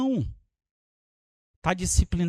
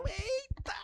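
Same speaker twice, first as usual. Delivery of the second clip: high startled exclamation; 13 dB quieter than usual. Retold or imitated. Spy sees couple enter motel.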